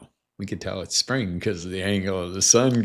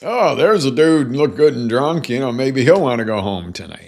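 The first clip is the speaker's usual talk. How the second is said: higher and louder mock barfly; lightly voiced bar-goer; 4 semitones higher, 6 dB louder than usual. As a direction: toss it off like a casual bar pickup. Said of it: "and go".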